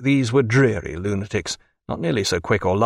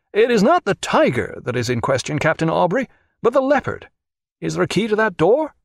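high-pitched, fussy interrogator; agitated official crying out; 7 semitones higher than usual. A narrator's reading. Brenton corrects Captain Aubrey, agitated and high. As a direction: question him in a raised, anxious pitch. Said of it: "he cried".

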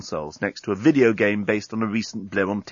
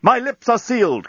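defiant; 12 semitones higher, 4 dB louder than usual. A narrator's reading. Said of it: "countered the journalist".

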